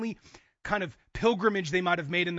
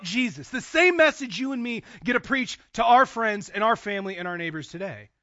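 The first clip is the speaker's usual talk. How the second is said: loud, higher, driving pulpit delivery; impassioned preaching; 5 semitones higher, 9 dB louder than usual. Real, not acted. Preacher announcing the Gospel finally being preached, impassioned. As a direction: proclaim it at full force, punching key words. Preacher builds to full force.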